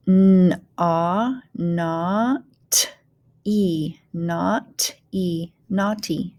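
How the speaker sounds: "naughty" built up from n, ah, not, e; teacher blending "naughty" with gaps between sounds; phonics blending. Shown as a demonstration. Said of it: "go ahead and start blending".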